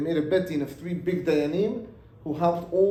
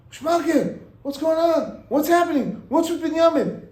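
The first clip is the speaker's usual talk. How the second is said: eager surprised greeting; 11 semitones higher, 6 dB louder than usual. Acted. Wife greets husband's friend eagerly.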